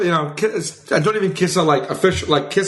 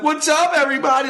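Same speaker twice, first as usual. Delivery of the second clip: goofy stoner hello; 10 semitones higher than usual. Retold or imitated. Stoned friend jumping out of bushes, high cheerful shout. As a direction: pop out and greet everyone like a stoned prankster.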